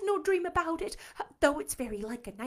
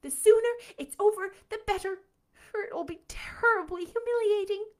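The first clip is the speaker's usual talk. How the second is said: higher, louder, stressed ALL; exclaiming; 8 semitones higher, 7 dB louder than usual. Acted. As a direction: burst out in resolute dismay. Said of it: "exclaimed Anne".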